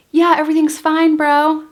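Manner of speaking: high, loud, overdone breezy reassurance; fake all-fine. Answering brother through the bathroom door, fake all-fine. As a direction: cover up with a too-bright high voice.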